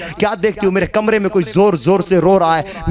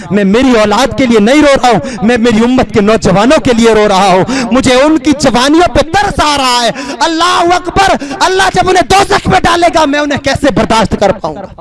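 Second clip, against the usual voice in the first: loud pleading outcry, climbing higher; impassioned preaching; 4 semitones higher, 7 dB louder than usual. Acted. Prophet explaining his tears to Ayesha, impassioned preaching. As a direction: cry it out to the congregation. Preaches a quote at full force.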